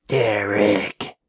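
drawn-out, voiceless whisper; eerie whisper. A narrator's reading. Unseen voice calling Derek from nowhere, whispered. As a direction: whisper the name like a disembodied call.

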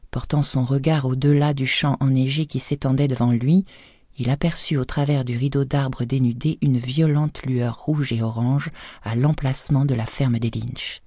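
ordinary delivery, nothing marked

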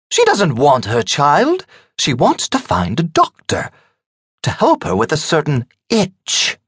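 high woman's voice relishing the joke; high, knowing gossip building to innuendo; teasing bawdy hostess. A narrator's reading. Margaret amusing dinner guests with innuendo, high and teasing.

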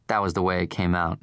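ordinary delivery, nothing marked

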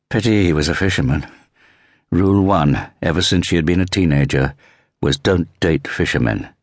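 ordinary delivery, nothing marked

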